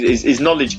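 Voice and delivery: ordinary talk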